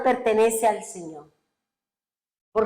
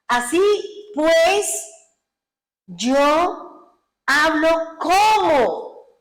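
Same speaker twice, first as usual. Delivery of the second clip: raised, loud, slow and drawn-out proclamation; emphatic scripture quote; 6 semitones higher, 4 dB louder, much slower than usual. Acted. Preacher quoting the Lord's words to the congregation, slow, loud and emphatic. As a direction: proclaim each word apart, with long pauses.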